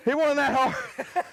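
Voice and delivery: high, slow, giggling protest; breathless giggly denial; laughing. Real, not acted. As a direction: laugh it off at your own expense. Laughing at himself, winded.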